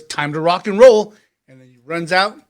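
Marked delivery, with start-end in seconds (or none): 0.0-1.1 loud, punchy shout into the room; hyped call-out. Acted. Man summoning fighters to the ring, loud call.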